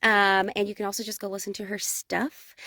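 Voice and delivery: ordinary talk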